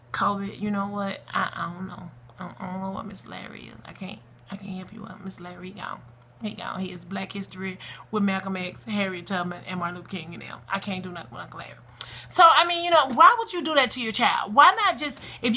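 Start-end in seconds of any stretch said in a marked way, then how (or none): 0.1-11.8 much softer, lower, evasive reply; hushed awkward explaining. Acted. Mother explaining to her son that the date is gone, hushed and sheepish. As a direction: let the volume drop away as you fumble an answer.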